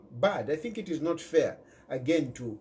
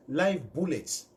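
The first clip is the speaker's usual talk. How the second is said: usual speaking voice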